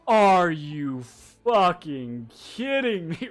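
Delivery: slow, drawn-out disbelief; slow incredulous groan of a line; exasperated realization. Acted. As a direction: stretch it out, word by word, in dismay. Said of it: "you have this realization and you're just like".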